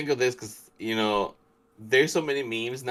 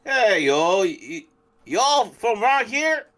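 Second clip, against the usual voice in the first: high, louder Southern put-on; Southern accent; 12 semitones higher, 5 dB louder than usual. Shown as a demonstration. Co-host practising the accent for his friend, high Southern voice. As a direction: ask it as a hammed-up Southern local, pitched high. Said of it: "You're getting better".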